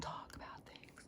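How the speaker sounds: hushed, unvoiced guru instruction; mock guru whisper. Guru telling followers to go sit in groups, whispered. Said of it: "I talk like this".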